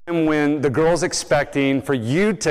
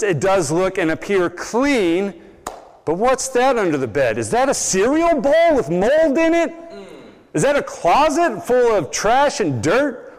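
rising, much higher disbelief as each mess is found; dismayed parent; 6 semitones higher than usual. Retold or imitated. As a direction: discover the mold and trash in growing shock. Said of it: "you're like".

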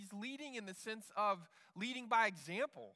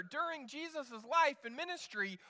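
usual speaking voice

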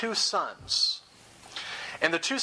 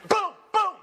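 two high, loud booms; imitated gunshots; 5 dB louder than usual. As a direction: punch out each boom sharply. Imitates more shots in retelling.

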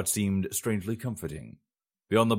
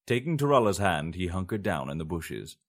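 usual speaking voice

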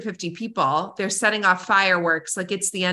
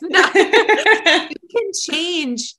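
louder, higher hammed-up motivational shout with laughter; Tony Robbins; 6 semitones higher, 4 dB louder, much slower than usual. Mimicking a self-help guru to listeners, hammed-up laughing shout. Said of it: "like Tony Robbins".

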